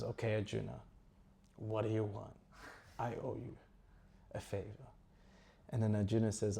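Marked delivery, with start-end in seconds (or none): 0.0-4.9 subdued, halting acted reply; downcast. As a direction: concede softly with long pauses. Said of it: "down, he's got his head down".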